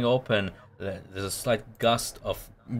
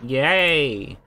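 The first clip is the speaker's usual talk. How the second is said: excited cheer; 5 semitones higher, 5 dB louder than usual. Acted. Prisoner cheers.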